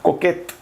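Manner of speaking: coquette with the final t sounded; French feminine coquette. Shown as a demonstration. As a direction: coquette ending in a clear t. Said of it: "Pero para decir coqueta".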